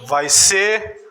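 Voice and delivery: slow phrases with pauses for repetition; leading congregational declaration. Shown as a demonstration. Preacher has church repeat after him, slow declaration. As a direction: lead the crowd phrase by phrase, drawn out. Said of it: "Fala comigo".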